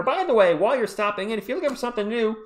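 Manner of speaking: mild announcer pitch; promo pitch. Acted. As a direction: sell it like a jingle. Channel promo read.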